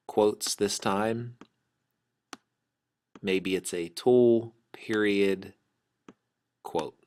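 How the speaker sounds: slow, spelling out punctuation; dictating. Shown as a demonstration. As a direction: read it out slowly, naming each mark. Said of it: "So it'd be Kelsey replied, comma".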